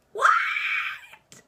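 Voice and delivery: high, breathless shout of disbelief; retold shocked shout. Reader learning the twist, shouting in shock. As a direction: yell it like the twist just hit you. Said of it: "I was like".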